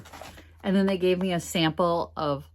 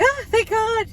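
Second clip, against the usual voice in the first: much higher burst of relief; relieved exclamation. Retold or imitated. Retelling her relief to the cashier, high relieved cry. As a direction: gasp it out, relieved. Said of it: "'Cause I'm like".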